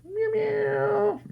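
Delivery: very high playful meowing; cat meow. Pretend. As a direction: meow like a little kitten. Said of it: "and say meow and peekaboo".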